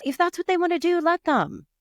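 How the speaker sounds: high-pitched exasperated daughter voice; mock daughter's high-pitched insistence; insistent daughter. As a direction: plead from way up high, as the retold daughter. Retold daughter interjecting at mom.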